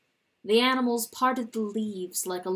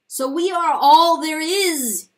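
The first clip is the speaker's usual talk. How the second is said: high, loud, slow accusation; accusing; 7 semitones higher, 12 dB louder than usual. Acted. Chanticleer accusing God, loud and high. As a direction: throw the blame at heaven. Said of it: "he accused".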